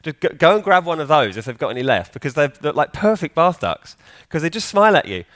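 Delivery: ordinary talk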